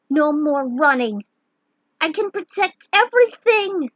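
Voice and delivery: loud, rising to a high vow; defiant cry. Acted. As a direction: declare it as your power awakens. Tara transforms, finds courage.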